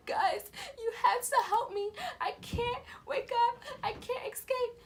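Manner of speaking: high-pitched acted crying; tearful pleading. Crying to parents for help out of a dream, tearful. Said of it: "I was crying, like, tears in my eyes".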